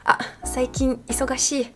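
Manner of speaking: soft apologetic Japanese brush-off; Japanese excuse voice. Retold or imitated. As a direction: act out a polite Japanese excuse. Matsumoto's excuse reply, acted.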